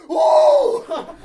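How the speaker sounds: very high, loud startled "Oh!"; shocked shout. Retold or imitated. Homeless man seeing the money in his hand, shouting in shock. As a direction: yelp like finding a fortune in your hand. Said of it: "just goes".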